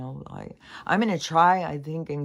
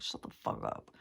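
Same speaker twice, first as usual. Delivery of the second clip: whispered shut the fuck up, much softer; muttered inner outburst at the song in her head; hissed to herself; 8 dB quieter than usual. Retold or imitated. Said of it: "It's like".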